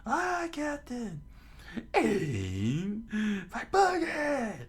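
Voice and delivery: high, drawn-out battle cries; hammed-up pirate crew shout; crew screaming. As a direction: yell the firing order shrieking high. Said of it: "To which his crew screams".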